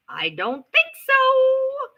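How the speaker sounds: high, drawn-out sarcastic "I don't think so"; slow sarcastic disbelief. Retold or imitated. Vlogger retelling telling Christy she's no friend, high and slow. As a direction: stretch it out, dripping with doubt. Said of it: "I said".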